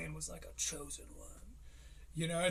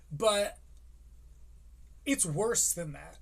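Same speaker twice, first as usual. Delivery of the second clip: usual speaking voice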